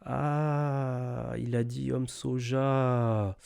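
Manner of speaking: slow, quiet, incredulous drawl; slow and soft, mocking disbelief. Real, not acted. Mocking the clip's phrase.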